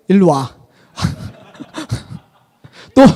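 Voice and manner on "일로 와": mock stern parent. Shown as a demonstration. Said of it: "울면서".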